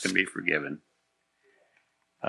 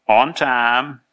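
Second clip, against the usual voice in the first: a touch louder, calling out; mild yell; 4 semitones higher, 6 dB louder than usual. A narrator's reading. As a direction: call it out like a pupil, half-strength. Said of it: "Another yelled".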